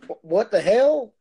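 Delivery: stunned disbelief. Real, not acted. Bum with a card reader story.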